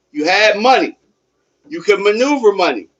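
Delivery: normal talking voice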